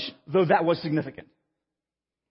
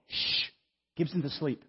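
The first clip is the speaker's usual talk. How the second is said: softer, lower shushing; retold hushing; 4 semitones lower, 7 dB quieter than usual. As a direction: hush someone for a sleeping baby. Wife hushes him, baby asleep.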